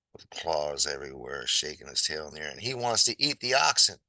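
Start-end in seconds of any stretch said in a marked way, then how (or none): none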